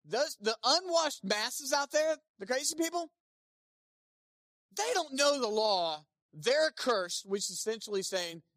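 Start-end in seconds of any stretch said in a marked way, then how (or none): 0.0-6.0 slow, high, loud disdain; contemptuous Pharisees. Retold or imitated. Pharisees sneering at the crowd, slow and contemptuous. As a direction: spit out the contempt. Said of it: "they amp it up".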